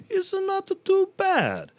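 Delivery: very high, slow squeaky aside; squeaky falsetto. Pretend. Comic squeaking about Taco John's in falsetto.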